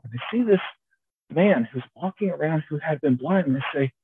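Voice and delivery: ordinary talk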